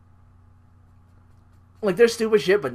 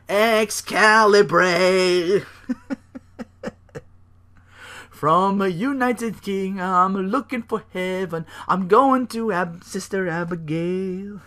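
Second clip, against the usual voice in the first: slow, high, eerie laughing mumble; creepy Bray ramble; 5 semitones higher, slower than usual. Retold or imitated. Bray rambling nonsense, slow and eerie. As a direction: drag it out like a mad prophet. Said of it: "Bray's like".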